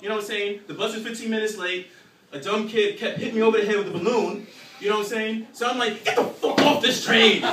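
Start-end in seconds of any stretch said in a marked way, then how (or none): 6.0-7.4 slow, loud angry bellow; mock furious yell. Angry commuter yelling at the man blocking the doors. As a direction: bellow it like you've lost it.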